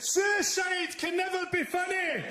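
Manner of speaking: high, slow, shouted outrage; hammed-up offended yell; mock indignant shout. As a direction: yell it back while storming out. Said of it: "turned around and went".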